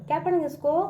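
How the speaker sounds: ordinary talk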